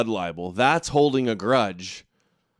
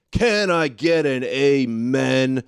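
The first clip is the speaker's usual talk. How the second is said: slow, drawn-out mock preacher call; hammed-up call for an amen, stretching each word; 4 dB louder, slower than usual. Streamer parodying a preacher to his viewers, mock preaching.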